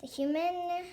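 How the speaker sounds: ordinary delivery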